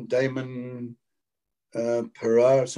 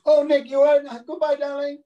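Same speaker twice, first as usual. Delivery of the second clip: high, loud, sing-song brush-off; gushing goodbye; 13 semitones higher, 6 dB louder than usual. Retold or imitated. Casting lady waving the actor out, high and loud. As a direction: toss him a breezy darling as he leaves.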